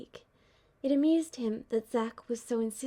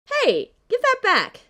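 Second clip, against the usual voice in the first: much higher, loud, slow yelp; 11 semitones higher, 12 dB louder than usual. Acted. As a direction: yelp as the bird grabs the wallet. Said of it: "Zack yelped".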